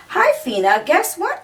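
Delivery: bright, rising "guess what" with a jump up high; eager question. Acted. Marlene phones her sister Fina with big news, eagerly. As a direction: tease the news to your sister. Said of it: "asked Marlene".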